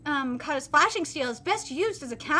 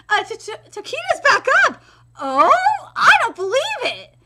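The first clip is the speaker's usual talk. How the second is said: higher, stammering astonished cry; stunned disbelief; 6 semitones higher, 7 dB louder than usual. Acted. Fighter stunned as opponent gets back up, amazed. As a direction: gasp out the shock.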